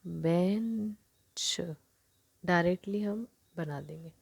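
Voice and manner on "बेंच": very slow, stretched बेंच; drawn-out demo word. Shown as a demonstration. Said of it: "तो हम circle का ना use करके सौ".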